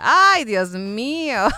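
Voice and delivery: long, drawn-out mock swoon; playful swooning. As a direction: swoon over it, stretching every word. Swooning over guest's deep voice.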